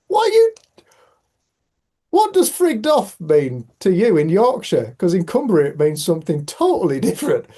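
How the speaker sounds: loud, high-pitched mock bafflement; retold disbelief. Baffled colleague questions Yorkshire slang, loud mock disbelief. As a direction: demand an explanation, imitating your own shock.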